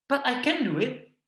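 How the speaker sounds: put-on high, insistent little kid; a child begging his dad; pleading child. Child arguing with father. (anak kecil merengek ke papanya)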